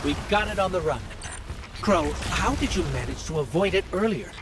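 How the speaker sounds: high, pressing voice in mid-chase; urgent combat callout. Acted. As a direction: call it out over the action, pitched high. Calling out during a fight.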